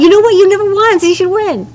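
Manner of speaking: high, loud, hammed-up generous voice; playful quote. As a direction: act out the giver all big and cheery.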